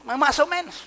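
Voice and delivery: high, shrugging "más o menos" voice; mocking a lukewarm member who shrugs everything off; mock lazy answer. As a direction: play the half-hearted believer. Mocking a mediocre churchgoer.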